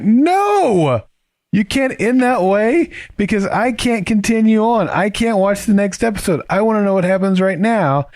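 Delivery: high-pitched mock-distraught whine; dramatic protest. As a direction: wail in exaggerated protest at being left hanging. Mock despair at cliffhanger ending.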